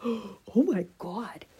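breathless hushed oh my God; shocked whisper. Retold or imitated. Seeing dark urine in a cup, a horrified whisper.

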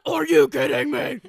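loud laughing mock rage; mock furious. Teasing her friend's hormone rage, loud and laughing. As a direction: blow up loud, laughing through it. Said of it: "steam coming out of your ears".

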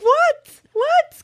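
very high mock-alarmed questions; high-pitched reenacted alarm; retold panicked what. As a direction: squeak it in sudden panic. Alarmed at Mom's reaction.

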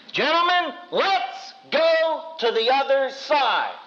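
high, slow call to the disciples; Jesus calling out. Retold or imitated. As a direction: call them across the lake, grandly. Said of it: "He said".

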